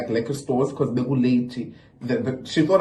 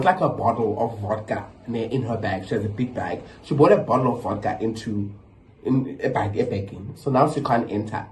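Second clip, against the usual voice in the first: normal talking voice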